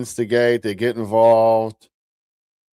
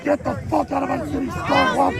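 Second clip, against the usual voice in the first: mock intimidating tough guy. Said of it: "they always talk like this, and they try to sound more intimidating".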